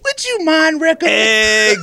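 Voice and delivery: high, slow exaggerated request; high mock ask. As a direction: play the hopeful client. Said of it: "quoted clients asking".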